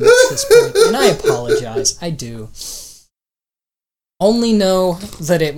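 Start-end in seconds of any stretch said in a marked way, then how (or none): 0.0-2.0 laughing. Real, not acted. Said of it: "apologizing while laughing".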